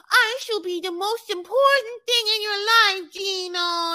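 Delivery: wife demanding to be first in his life; mimicking Jasmine. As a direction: declare it like a queen. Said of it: "she very much is like".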